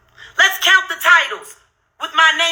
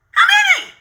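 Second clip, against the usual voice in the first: outraged shout. Real, not acted. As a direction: yell it in sheer disbelief at the count. Outraged at how many videos.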